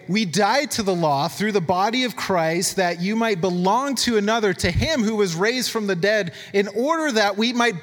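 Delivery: high, slow declaiming of scripture; emphatic scripture reading. Acted. Preacher reads Romans to the church, emphatic scripture reading.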